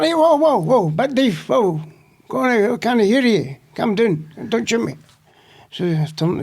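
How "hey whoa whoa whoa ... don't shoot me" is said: higher, jittery commands to a prisoner; lightly voiced jumpy policeman; panicky cop voice. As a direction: play the nervous armed cop.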